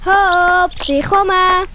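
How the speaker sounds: höher und lauter herbeirufen; ruft von weitem. Acted. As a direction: ruft dem erschöpften Freund zu, dass Hilfe kommt. Ruft, rennt mit Wasser herbei. (laut und hoch herüberrufen)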